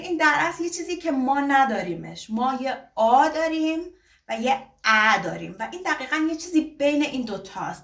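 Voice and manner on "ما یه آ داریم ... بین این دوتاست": usual speaking voice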